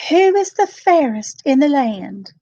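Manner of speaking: high, loud haughty queen; queen's voice. A narrator's reading. Queen questioning the magic mirror, haughty demand.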